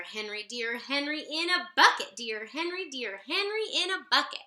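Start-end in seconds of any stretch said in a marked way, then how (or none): none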